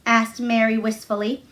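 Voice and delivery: ordinary delivery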